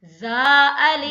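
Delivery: जा held for two beats, loud and slow; tajweed recitation. Shown as a demonstration. Teacher recites for students, loud slow chant. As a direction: chant जा अलिफ, stretching जा. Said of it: "अदा कीजिएगा।".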